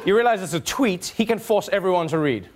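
ordinary delivery

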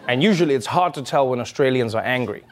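usual speaking voice

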